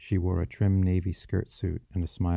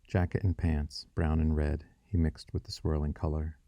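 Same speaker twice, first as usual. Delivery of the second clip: ordinary delivery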